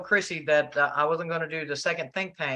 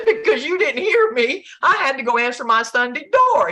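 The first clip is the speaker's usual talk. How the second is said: laughing complaint; 8 semitones higher, 7 dB louder than usual. Retold or imitated.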